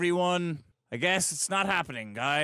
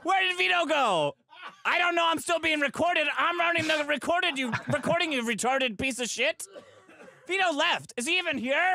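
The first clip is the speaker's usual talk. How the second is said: frantic; 8 semitones higher than usual. Real, not acted. Frantic rant about Vito leaving.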